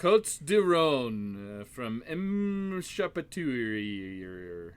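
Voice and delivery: slow, hammed-up French accent; overdone French wine-snob accent. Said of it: "my French accent is awesome".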